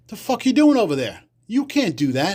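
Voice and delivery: high, hard tough-guy challenge; mock mobster anger. Bronx mobster tells him he can't do that, high and hard. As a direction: lean on him like a Bronx enforcer. Mobster confronts gumball machine.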